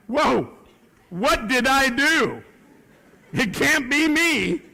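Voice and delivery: loud, high, comic panic; mock alarm. Man reacting to a woman saying love, mock alarm. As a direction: protest in hammed-up shock, high and drawn out.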